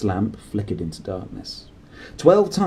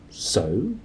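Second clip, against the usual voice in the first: ordinary delivery, nothing marked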